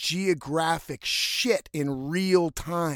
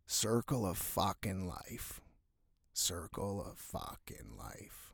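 put-on calm old man; 7 semitones lower, 5 dB quieter than usual. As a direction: soothe him like a wise old fisherman. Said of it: "real calmly".

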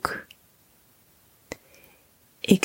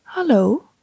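a light, raised friendly animal hello; friendly koala greeting; 4 semitones higher than usual. Acted. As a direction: greet warmly as a sweet little koala. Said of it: "kijkt hij me aan en zegt". (vriendelijke koalastem)

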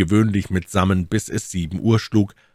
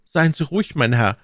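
high-pitched servant reassuring his master; higher, assured servant voice; confident servant; 9 semitones higher than usual. A narrator's reading.

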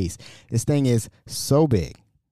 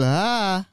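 a short, higher imitated scream, still in control; higher mock scream; 7 semitones higher than usual. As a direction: toss off a little scream as on a fast ride. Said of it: "it's a little bit like".

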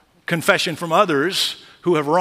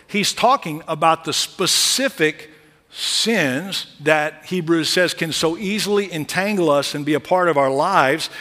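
ordinary delivery

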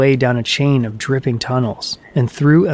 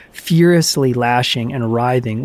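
ordinary delivery, nothing marked

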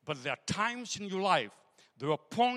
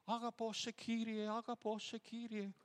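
soft Greek prayer; 4 semitones higher, 9 dB quieter than usual. Retold or imitated. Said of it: "praying in tongues".

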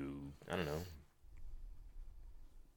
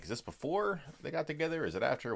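higher-pitched puzzled self-quote; confused questioning; 9 semitones higher than usual. Retold or imitated. Viewer recalling confusion over the movie's timeline, puzzled. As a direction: act out your own confusion in a raised voice. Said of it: "this is what was confusing to me".

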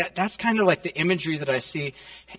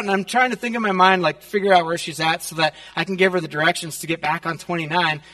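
usual speaking voice

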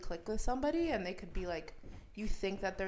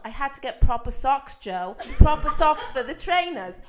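loud, raised mock-British protest; Freya yelling back; 4 semitones higher, 14 dB louder than usual. Girlfriend defends the trainers' price to boyfriend, yelling in British accent. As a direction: argue back loudly in a put-on British accent. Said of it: "I would say more screaming".

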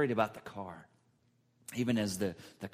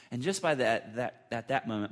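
ordinary delivery

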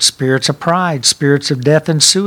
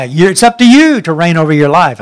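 louder, urgent exhortation; rally them with a louder push; rousing call; 8 dB louder than usual. Real, not acted. Preacher urging the church to take authority, louder and rousing.

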